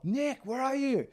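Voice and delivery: high, drawn-out call across a shop; long high-pitched shout-out. Retold or imitated. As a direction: holler for someone, stretching the words. Dad calling across shop.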